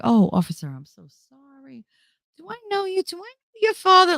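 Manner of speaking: high, sugary sweet-talk to the officer; butter up the cop, pitch way up. Retold or imitated. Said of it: "she'll do the other and be like".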